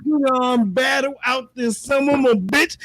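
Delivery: ordinary talk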